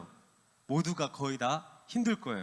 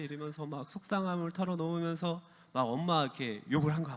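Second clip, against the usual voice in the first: usual speaking voice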